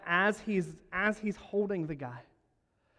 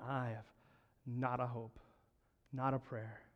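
despairing servant; 5 semitones lower, 9 dB quieter than usual. Acted. Servant despairing over debt.

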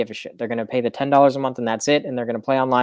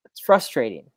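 ordinary delivery

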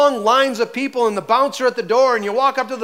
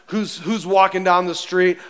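ordinary talk

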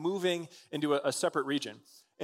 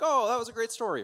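higher, louder burst of enthusiasm; impressed gush, pitched up; delighted reaction; 8 semitones higher, 4 dB louder than usual. Acted. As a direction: react like a thrilled first-time viewer. Viewer's pleased first reaction.

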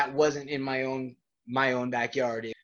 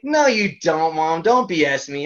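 raised, louder protest; disbelieving kid; 3 semitones higher, 6 dB louder than usual. Retold or imitated. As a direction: re-enact a kid calling mom's bluff. Boy disbelieving his mom.

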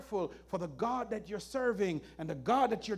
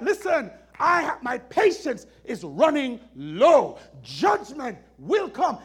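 loud stern warning; 9 dB louder than usual. Acted. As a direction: warn them with force. Preacher voices God's warning.

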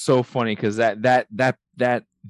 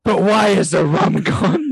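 high, loud, indignant whining question; pirate lament; 7 semitones higher, 4 dB louder than usual. Retold or imitated. The pirate asking why the rum was burned, high and loud. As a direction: plead for the lost rum. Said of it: "He's like".

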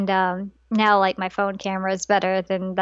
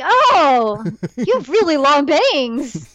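surprised; 4 semitones higher, 4 dB louder, much slower than usual. Retold or imitated. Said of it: "She's like".

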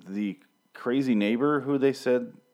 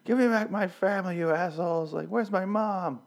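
much higher mock whiny wail; mocking Ophelia; 11 semitones higher than usual. Reviewer mocking Ophelia yelling at soldiers, high whiny. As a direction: squeal it like a hammed-up crying girl. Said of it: "Ophelia is throwing bottles ... going like".

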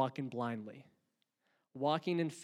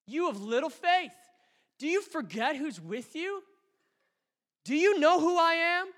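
high, loud, pleading voice of Jesus; Jesus rebuking; 11 semitones higher, 4 dB louder than usual. Acted. Jesus challenging his frightened disciples, high and loud. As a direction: press the disciples with each question. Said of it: "Jesus looked at the disciples and says".

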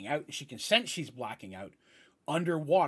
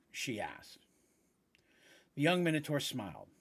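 story narration. Acted. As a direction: read it like a novel, pausing for effect.

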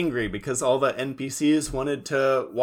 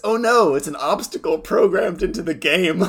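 loud, higher-pitched mock alarm; hammed-up cartoon hero announcing danger; Yugi; 6 semitones higher, 5 dB louder than usual. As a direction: cry it out in mock dismay. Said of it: "I think it's Yugi who says".

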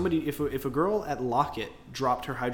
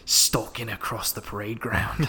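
slow, hushed-drama Aussie nature narrator; Australian documentary voice; 3 semitones lower than usual. Shown as a demonstration. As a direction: draw it out like an Australian wildlife host. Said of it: "the documentary voice when I do Australian".

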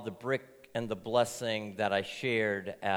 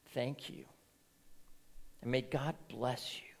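grateful whisper; 8 dB quieter than usual. Acted. Grateful child thanking stranger.

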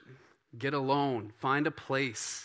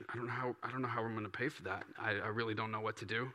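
much softer, hurried anxious plea; anxious prayer; 3 semitones lower, 11 dB quieter, faster than usual. Acted. Believer praying over a dentist bill, soft and anxious. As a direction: mutter it like someone at a loss.

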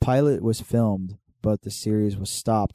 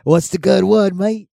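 high, loud Australian "what's the good word, mate"; a cartoon Aussie greeting, pitched up; hammed-up Australian voice; mock Australian accent; 10 semitones higher, 9 dB louder, slower than usual. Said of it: "Australian accent".

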